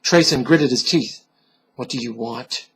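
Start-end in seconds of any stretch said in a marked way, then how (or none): 1.7-2.7 much softer, lower, held breath; hushed fearful demand; tense hushed demand. Acted. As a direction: ask the dark in a hushed voice. Tense whisper in the dark.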